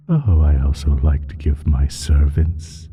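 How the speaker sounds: ordinary talk, nothing marked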